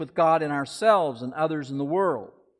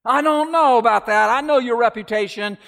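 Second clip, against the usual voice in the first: wary refusal; 8 semitones higher, 8 dB louder than usual. Acted. Young man refuses the snake.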